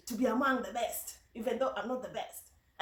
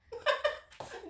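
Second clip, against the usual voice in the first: high burst of laughter; amused laugh; 12 semitones higher than usual. Real, not acted. Laughs at the name confusion.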